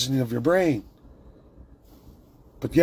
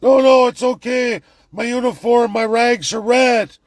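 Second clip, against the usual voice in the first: higher, louder mock reassurance; mock reassuring victim; 8 semitones higher, 6 dB louder than usual. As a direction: parody someone calming themselves in denial. Mocking complacent prisoner voice.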